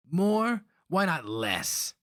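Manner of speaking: put-on high, indignant young voice; boy's bitter retort. A narrator's reading. Young Holka boy retorting bitterly to the slaver. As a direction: throw the word back at him.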